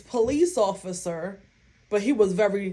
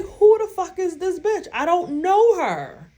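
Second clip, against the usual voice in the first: high, louder indignant outburst; who does she think she is, said up high; retold disbelief, pitched high and loud; 9 semitones higher, 4 dB louder than usual.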